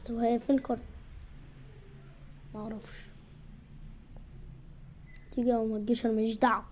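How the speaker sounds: ordinary talk, nothing marked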